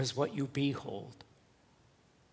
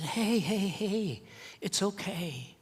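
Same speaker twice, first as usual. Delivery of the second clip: soothing; 6 semitones higher, slower than usual. Retold or imitated. Comforting crying boy.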